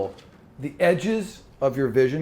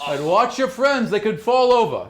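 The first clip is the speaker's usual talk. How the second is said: higher, louder call from across the deck; sailor shouting; 8 semitones higher, 4 dB louder than usual. Acted. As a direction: shout over to the crew, concerned. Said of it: "someone is shouting in the back of the ship".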